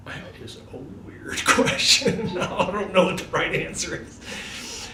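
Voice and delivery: laughing disbelief. Retold or imitated. Answering guards' strange statue question, laughing in disbelief. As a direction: giggle at how absurd the question is.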